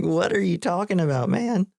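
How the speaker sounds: high-pitched mock bewilderment; baffled protest. The dude answering Walter's ethos remark, high baffled protest. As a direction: pitch jumps way up in disbelief. Retelling the dude's baffled reply.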